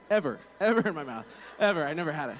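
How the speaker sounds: real laugh breaking into an emphatic denial; amused insistence. Real, not acted. Speaker correcting himself to listeners about the insult, laughing. Laughing at own embarrassing clarification.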